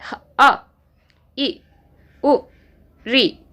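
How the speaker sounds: अ ई उ री each said short and clipped apart; short vowels demo. Shown as a demonstration. Teacher to class, demonstrating short vowel length. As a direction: tap out अ, ई, उ, री as quick single beats. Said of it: "जितना हम एक बार में पलक झपकाते हैं".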